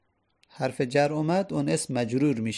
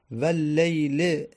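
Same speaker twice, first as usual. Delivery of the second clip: Quranic recitation; much slower than usual. Shown as a demonstration. Teacher recites Quran verse example.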